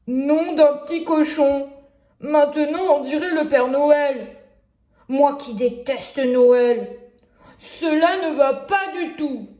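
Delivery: loud, indignant wolf voice, dragging out the line about Christmas; the wolf outraged at looking like Santa; wolf exclaiming. Acted. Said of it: "et s'exclama".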